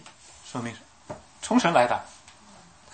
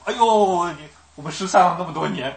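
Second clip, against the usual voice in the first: higher, louder hammed-up wail; mock tearful reunion; 5 semitones higher, 9 dB louder than usual. Preacher mocking a cliché teary hug, hammed-up wail. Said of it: "我们人以为哭就抱头痛哭".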